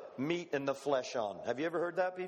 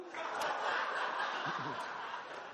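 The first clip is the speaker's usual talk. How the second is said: breathy laugh; 3 semitones lower, 4 dB quieter than usual. Real, not acted. Laughs at son's complaint.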